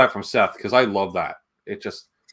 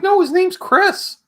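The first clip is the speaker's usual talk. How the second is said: high, slow, drawn-out retort; playfully imitating himself setting a friend straight; mock correction; 4 dB louder, slower than usual. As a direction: stretch it out in a much higher mock voice. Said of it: "I'm like".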